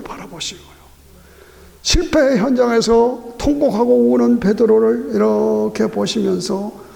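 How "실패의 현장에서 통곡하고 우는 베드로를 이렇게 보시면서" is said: fervent preaching. Acted. Preacher urging the flock to rise again, fervent and building. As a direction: drive it home to the congregation.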